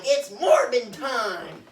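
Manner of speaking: slow, loud, drawn-out battle cry; hammed-up hero catchphrase. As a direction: announce it like a cheesy superhero. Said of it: "and he's like".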